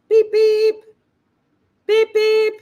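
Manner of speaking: loud, high-pitched car horn beeps; shrill-pitched mock horn, beep beep; imitated car horn. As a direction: honk it out like an impatient car horn. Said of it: "Here's what I hear outside the house".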